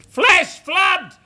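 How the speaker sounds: very high, loud false alarm; mock alarm shout. Prisoner tricking the firing squad, mock alarm shout. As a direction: yell it as a sudden diversion.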